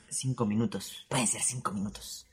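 soft, dramatic whisper; hushed whisper. Acted. Comedian musing on five minutes of fear, hushed whisper. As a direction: drop to a whisper for effect.

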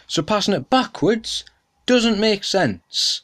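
ordinary delivery

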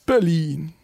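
stretched-out high arena announcer call; lucha libre announcer. Pretend. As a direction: drag out Aus Berlin like a ring announcer. Band intro like an announcer.

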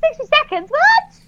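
high squeaky what; surprised. Real, not acted. Guest startled by a sixty second limit, high surprise. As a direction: react in disbelief at the time limit.

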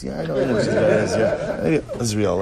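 chuckling through the words; laughing. Real, not acted. Laughing as he concedes to the students.

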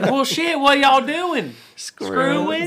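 squeaky old-man impression; old TikTok dude. Imitating an old dude from TikTok for friends, high and loud. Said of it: "It's like that old dude on TikTok".

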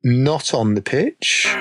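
usual speaking voice

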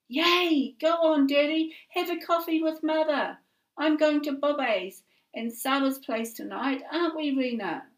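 put-on high, loud, thrilled little girl; a child bursting with joy; excited girl. A narrator's reading. Daughter cheering on her parents going for coffee, high and excited.

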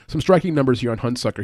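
ordinary talk, nothing marked

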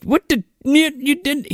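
high mock Cosby mumble; goofy Bill Cosby voice; Bill Cosby impression. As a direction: ham up a Cosby bit. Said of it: "Kenan's doing his best fucking Bill Cosby".

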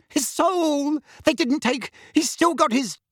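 high-pitched, horrified woman's voice; panicked aunt. A narrator's reading. Aunt fears her son's soul was taken, panicked. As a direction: break in high and alarmed, leaving it unfinished.

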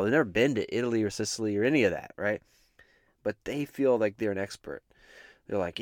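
ordinary talk, nothing marked

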